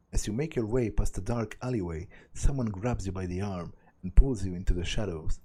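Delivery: ordinary delivery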